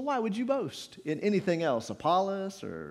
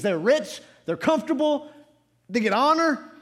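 higher, pressing pulpit delivery; forceful preaching; 6 semitones higher, 5 dB louder than usual. Real, not acted. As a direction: press the point on the congregation.

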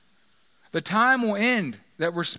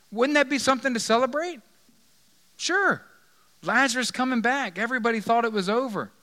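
high, loud exclaiming; excited celebration; 7 semitones higher, 4 dB louder than usual. Real, not acted. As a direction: celebrate the news at full lift. Enthusing about celebrating Lazarus's return.

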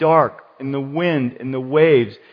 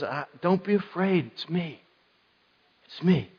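soft, understated comforting; gentle reassurance; 2 semitones higher, 8 dB quieter than usual. Acted. Preacher voicing Jesus to frightened disciples, softly reassuring. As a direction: say it quietly to calm their fear.

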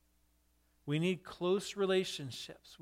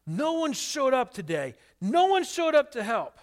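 high, loud frustrated complaint; angry outburst; 8 semitones higher, 7 dB louder than usual. Retold or imitated. Randy tells Jeff he's mad, loud and frustrated. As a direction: vent at being left to work alone.

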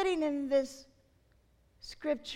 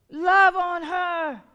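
slow and louder, leaning hard on Love; forceful plea; 7 dB louder, slower than usual. Acted. Preacher repeats God's command to the congregation, slow and loud. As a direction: drive the words home from the pulpit.

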